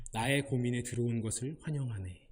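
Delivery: God tells Bruce in a low, soft, wry voice to share the answer; God answering gently. Retold or imitated. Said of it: "하나님이 브루스에게 말씀하십니다".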